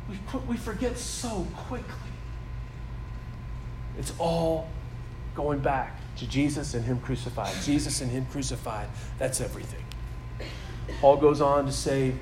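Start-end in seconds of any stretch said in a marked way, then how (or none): 0.1-9.8 soft heartfelt appeal. Acted. Pastor tells the new church its heart's desire, softly. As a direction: lower and softer, intimate preaching.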